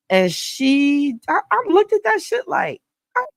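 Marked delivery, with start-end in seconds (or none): 3.2-3.3 high, fast, incredulous; facing a rabid dog, startled; startled disbelief. Retold or imitated. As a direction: snap it out in shock. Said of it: "like, startled".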